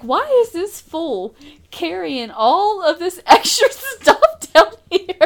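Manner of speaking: amused, slow, laughing; laughing mock onlookers. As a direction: laugh through the quote. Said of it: "They're like".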